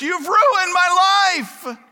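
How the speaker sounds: put-on loud, slow, high wail of an accusing child; whiny kid outburst. A child yelling at their father in accusation, high and drawn out. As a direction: throw it like a teen blaming a parent.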